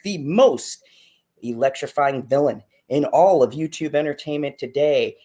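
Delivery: loud, hyped wrestling-announcer build-up; big announcer intro. Acted. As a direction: sell the show like a ring announcer. Host opens the show.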